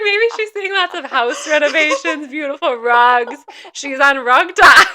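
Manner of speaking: loud, laughing through the words; amused and loud, giggles breaking in; laughing self-mockery. Real, not acted. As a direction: drag it out while cracking up. Laughing at own wrong guess.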